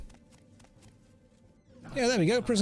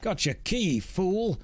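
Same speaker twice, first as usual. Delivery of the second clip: taunting; 7 semitones higher, slower than usual. Pretend. Taunting a locked-out guard.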